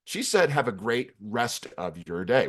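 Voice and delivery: each word spaced out deliberately; slow, clear repeat. Acted. Shoulder bug reveals what she said, slow and clear. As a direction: spell out the sign-off slowly, word by word. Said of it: "which is what she said".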